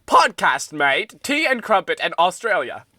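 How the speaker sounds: loud hammed-up British, drawn out; put-on British voice. Host repeating the intro to co-host, hammed-up British accent. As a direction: ham up a bad British accent loudly. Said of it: "I'm a little bit British".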